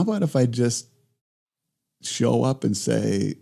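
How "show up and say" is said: usual speaking voice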